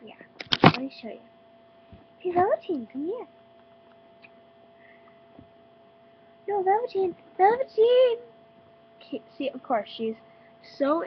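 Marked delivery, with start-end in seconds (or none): 2.2-8.2 high, loud call to a bunny; calling a pet. Real, not acted. Calling her bunny over.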